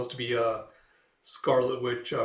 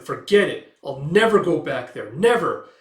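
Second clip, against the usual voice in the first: much louder, NEVER punched high; angry refusal; 3 semitones higher, 10 dB louder than usual. Retold or imitated. Gail refusing to go back, shouting. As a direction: slam the door on it.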